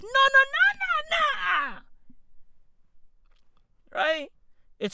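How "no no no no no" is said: very high mock-panicked refusal; body in protest. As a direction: squeal it like a body fending off invaders. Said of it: "The female body goes".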